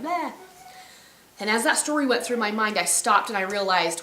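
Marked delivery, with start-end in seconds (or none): 0.0-0.3 put-on lone "bleh" as an injured kid goat; imitated weak goat bleat. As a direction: bleat once like a goat lying helpless. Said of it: "he's just laying there like".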